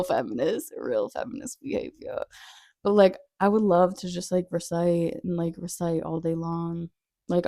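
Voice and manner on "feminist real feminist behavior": fading aside. Real, not acted. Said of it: "I know that's some".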